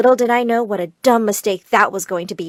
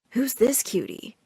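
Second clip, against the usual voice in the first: softer, slow flirting; soft flirty line; 7 dB quieter, slower than usual.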